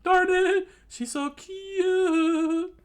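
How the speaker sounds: loud falsetto, drawn-out ending; hammed-up infatuated whine; lovesick swooning. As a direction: squeal helplessly, stretching the last word. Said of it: "His eyes turned to hearts".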